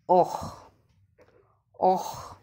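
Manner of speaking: oh ending in a breathy throaty ch; demonstrated oh. Shown as a demonstration. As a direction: let oh fade into a rasp at the back of the mouth. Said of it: "O C H sound is".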